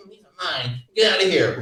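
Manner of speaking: a hint of a whiny nerd, soft; lightly voiced nerd mouthing off; nerdy mock voice. Guy mouthing off to a bigger man, mock nerd voice. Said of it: "mouthing off to me like a nerd".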